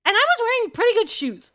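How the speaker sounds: high, loud, eager burst to open the story; excited opener. Real, not acted. Excited start of shoe story.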